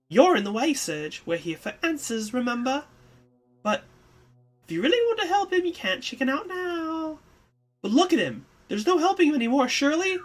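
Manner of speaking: put-on high, loud, pushy young woman; Kid, insistent. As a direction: push past him, impatient. Kid pressing Serge, arguing.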